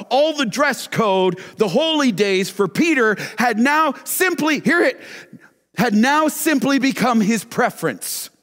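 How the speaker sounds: high, loud preacher's cry, stretched out; fired-up preaching. Acted. Preacher urging the congregation onto the rooftop, fired up. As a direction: rouse the church to picture the rooftop.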